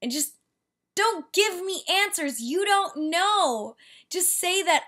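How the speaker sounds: high, slow indignant rant. Real, not acted. Ranting at the car wash man, high and indignant. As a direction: lecture him, each word pointed.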